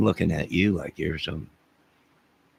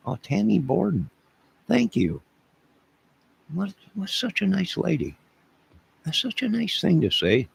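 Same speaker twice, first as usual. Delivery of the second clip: much higher, gushing thank-you; delighted thanks; 10 semitones higher than usual. Real, not acted. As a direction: gush over the kind words. Thanking a viewer for a comment.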